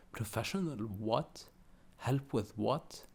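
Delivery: puzzled questions, pitch lifting on "what"; baffled. A narrator's reading. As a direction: ask in bewilderment, leaning on each "what". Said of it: "Hinaya said, baffled".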